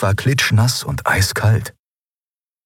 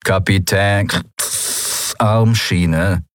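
machine voice; much slower than usual. A narrator's reading. Machine head speaks last time.

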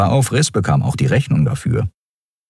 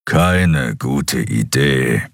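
low, very slow rumble; slow brute-creature voice; grumbling giant; 5 semitones lower, much slower than usual. A narrator's reading. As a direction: grumble it deep and drawn out. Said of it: "brummte der Skrier".

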